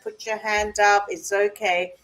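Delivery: ordinary delivery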